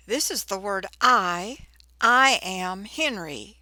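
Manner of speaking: ordinary delivery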